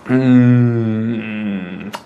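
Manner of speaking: ordinary delivery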